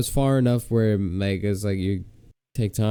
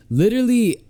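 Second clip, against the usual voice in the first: normal talking voice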